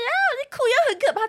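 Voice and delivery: a high, squealing mock-scared line; mock horrified cry. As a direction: shriek it out in pretend horror. Imagined reaction to the cockroach.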